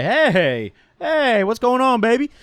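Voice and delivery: loud, high, drawn-out come-on; flirty catcall.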